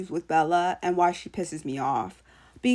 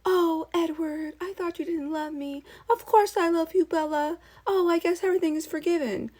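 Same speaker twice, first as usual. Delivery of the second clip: mocking Bella; 7 semitones higher than usual. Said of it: "Bella's just like".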